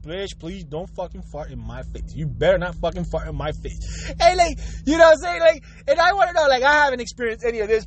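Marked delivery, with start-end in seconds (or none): 0.1-4.1 low, hushed, menacing plea; inner-thought voice. Acted. Silently begging partner not to fart, in a low hush. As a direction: say it privately, low and under the breath. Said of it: "you're in your mind, you're saying".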